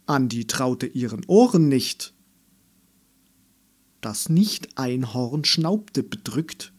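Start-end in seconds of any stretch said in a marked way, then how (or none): none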